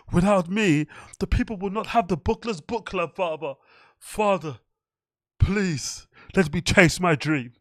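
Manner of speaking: lightly high, pleading whine; mock begging to chase a dream; big boy voice. Mocking a comedian pleading with his father to chase comedy. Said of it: "he puts his big boy voice on".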